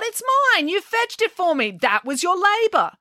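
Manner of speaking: high, petulant royal whine; a greedy king grabbing back his prize; high childish protest; outraged King Eurystheus. Acted.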